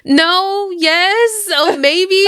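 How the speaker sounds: high-pitched, imitated guilty dithering; mock flustered. Carl caught out on the phone by Rick, flustered. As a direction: waver between answers in a high voice.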